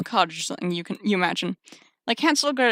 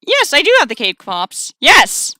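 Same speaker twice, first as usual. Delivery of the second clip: loud, raised, frightened blurt; shaky shout; 5 semitones higher, 7 dB louder, slower than usual. Acted. Terrified delivery worker answering giant spider, shaky shout. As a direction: shout it nervously, voice shaking. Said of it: "Your voice shakes as you shout".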